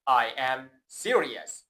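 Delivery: "I am serious" said very slowly, each word clear; slow model sentence. Shown as a demonstration. Teacher modelling "I am serious" slowly for the class to repeat. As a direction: spell it out for the learner to copy. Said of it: "با من تکرار کن".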